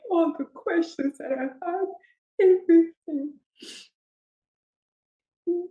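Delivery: tearful, overwhelmed. Real, not acted. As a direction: pass on the loving words through tears. Overwhelmed, relaying God's words.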